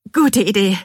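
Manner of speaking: high, loud, strained agreement; forced out. A narrator's reading. Narrator holding it together before the workman, forced out. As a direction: squeeze out an agreement. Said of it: "presse ich hervor".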